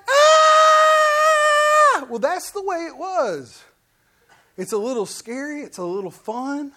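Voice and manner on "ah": long, high, loud roller-coaster scream; mock terrified scream. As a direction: hold one sustained shriek of pretend terror. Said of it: "they get to the top and it's like".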